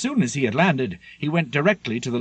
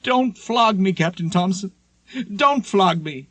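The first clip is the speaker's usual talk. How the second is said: high, desperate begging not to be flogged; frightened pleading cry; 7 semitones higher than usual. A narrator's reading. Sailor begging the captain on deck, panicked high pleading. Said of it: "calling out".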